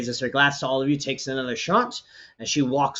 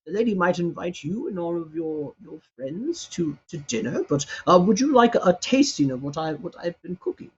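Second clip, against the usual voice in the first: put-on higher voice for a kindly old man; a humble old cook offering food, lightly higher; 4 semitones higher than usual. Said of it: "he looks at you and he goes".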